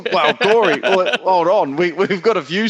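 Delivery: cracking up while teasing his mate; laughing. Real, not acted. Laughing as he teases Corey about the story.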